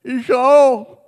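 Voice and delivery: pretend shout. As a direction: call out to be included. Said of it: "hätte ich geschrien".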